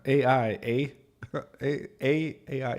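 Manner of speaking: playful Canadian A I, eh? with a lifted eh; mock Canadian. Teasing himself as a Canadian, a light mock Canadian accent. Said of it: "my Canadian accent".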